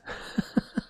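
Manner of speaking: unvoiced, airy chuckle; real amused chuckle; laugh. Real, not acted. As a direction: laugh it off in short breathy bursts. Laughs after saying lucky git.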